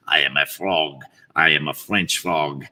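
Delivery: put-on low French-accented frog. Frog announcing what he is, low French accent. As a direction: introduce yourself in a thick French accent. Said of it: "give him some sort of a foreign accent".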